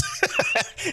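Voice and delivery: squeaky burst of laughter; high laugh. Real, not acted. Gloating over the wife's slip, high laugh.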